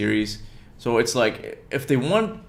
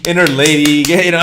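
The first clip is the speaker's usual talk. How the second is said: louder, teasing call; playful raised voice; 4 semitones higher, 8 dB louder than usual. Acted. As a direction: tease it out loudly. Mock catchphrase amid laughing banter.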